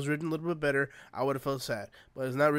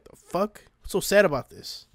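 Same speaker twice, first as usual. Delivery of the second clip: baffled; 4 semitones higher, 6 dB louder than usual. Retold or imitated. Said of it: "I'm like".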